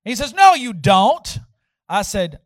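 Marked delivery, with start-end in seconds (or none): 0.3-1.4 loud, slow retort; indignant contradiction. Retold or imitated. Leader to the student who claims greater power, indignant retort. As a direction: imitate a drawn-out scoffing denial. Leader argues back in quarrel.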